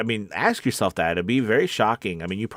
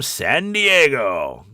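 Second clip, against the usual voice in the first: loud, raised, stretched-out city name; announcer-style shout-out; 4 semitones higher, 5 dB louder, much slower than usual. Pretend. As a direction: draw the place name out big like an announcer. Hails viewer's city like an announcer.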